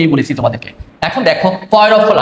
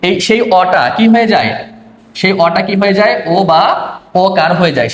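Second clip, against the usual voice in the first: ordinary talk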